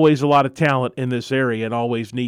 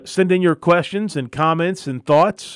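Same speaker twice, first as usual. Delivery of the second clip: normal talking voice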